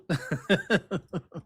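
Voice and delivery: soft laugh trailing off; a breathy fading chuckle; real laugh. Real, not acted. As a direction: laugh quietly to yourself. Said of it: "that makes me laugh".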